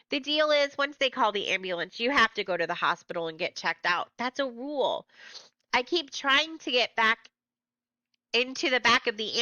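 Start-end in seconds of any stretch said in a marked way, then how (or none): none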